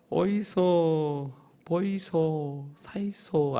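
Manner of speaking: slow, drawn-out Busan dialect hawker call; Busan market call. Retold or imitated. Pastor imitating Jagalchi market vendors, slow Busan dialect call. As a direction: stretch it like a Busan fish-market seller. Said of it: "경상도 말로, 부산 말로".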